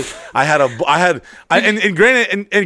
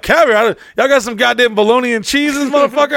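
incredulous; 9 semitones higher than usual. Acted. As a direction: scoff at the very idea, pitched up. Incredulous reply to caviar waiter.

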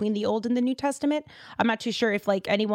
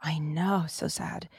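whispered, softer and lower; sad whisper; 5 semitones lower, 6 dB quieter, slower than usual. Real, not acted. Host whispering sadly over Rachel dying in childbirth, whisper. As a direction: hush it as if the news hurts.

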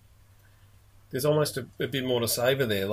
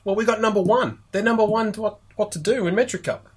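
excited, higher-pitched announcement; real excitement, pitched up; 7 semitones higher than usual. Real, not acted. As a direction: share big news with excitement. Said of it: "I was excited when I read that".

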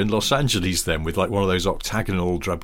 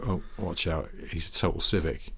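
low, soft aside; hushed warning; 4 semitones lower, 8 dB quieter than usual. Retold or imitated. Schoolmate warns of a townie lad, hushed retold aside. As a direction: imitate a discreet schoolboy warning.

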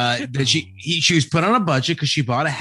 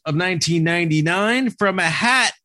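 host intro; 6 semitones higher than usual. Acted. Show host opening.

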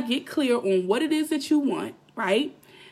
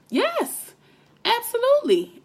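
excited; 12 semitones higher than usual. Real, not acted. Emphatic agreement in rant.

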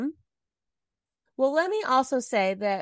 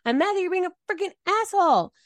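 angry retort; 9 semitones higher, faster than usual. Retold or imitated. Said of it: "And I said".

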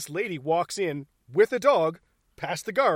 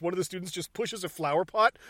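usual speaking voice

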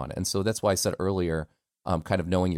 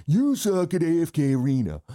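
much higher, put-on politician impression; Donald Trump; 10 semitones higher, 4 dB louder than usual. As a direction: do a high-pitched, mocking presidential voice. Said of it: "wanted me to play Donald Trump".

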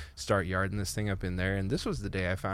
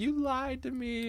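crying to dad; slower than usual. Retold or imitated. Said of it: "'cause I'm looking at my dad crying".

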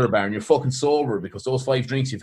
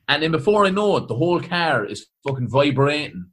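ordinary delivery

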